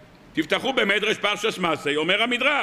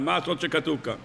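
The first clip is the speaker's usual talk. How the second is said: ordinary talk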